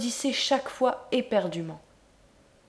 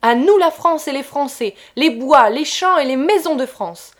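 loud, higher, triumphant toast; booming victorious toast; 5 semitones higher, 8 dB louder than usual. A narrator's reading. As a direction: raise the glass and proclaim it. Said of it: "répéta".

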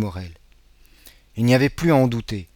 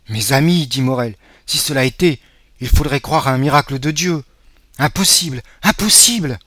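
incredulous; 4 semitones higher, 7 dB louder than usual. Acted. Father refusing to believe.

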